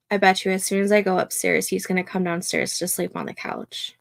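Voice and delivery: usual speaking voice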